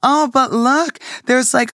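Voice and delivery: coaxing parent voice. Pretend. Mock parent to boyfriend, coaxing him like a toddler to eat. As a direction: coax a picky child into tasting.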